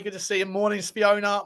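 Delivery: normal talking voice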